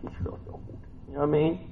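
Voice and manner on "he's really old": hushed near whisper after a laugh; mock a teenager sneering at an old man; young person's scoff. Preacher imitating a young person sizing him up, laughing hushed scoff. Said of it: "you think".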